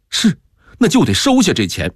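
mildly worked-up, fast demand; agitated insistence. A narrator's reading. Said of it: "萧敬之急了".

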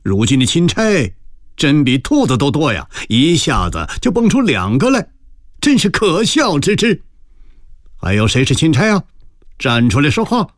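scornful cold laugh, pitched high; sneering mockery. A narrator's reading. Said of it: "拍案冷笑道". (冷笑着高声讥讽)

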